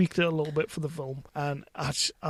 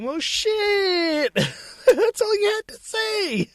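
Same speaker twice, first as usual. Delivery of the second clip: much higher, slow, laughing Samuel L. Jackson voice; Sam Jack impression; much slower than usual. Host imagining Samuel L. Jackson in the scene, laughing mock impression. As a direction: laugh through a hammed-up Samuel L. Jackson line. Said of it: "Sam Jack saying".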